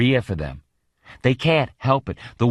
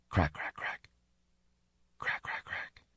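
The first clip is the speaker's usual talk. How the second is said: crackling sound breathed out unvoiced; whispered crackle; 5 semitones lower, 14 dB quieter than usual. A narrator's reading. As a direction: hiss out a dry crack crack crack, fading away. Imitated crackling noise heard.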